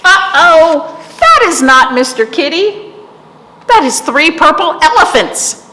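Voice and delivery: hammed-up uh-oh; mock alarm. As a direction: exclaim it for little kids. Mock alarm at story reveal.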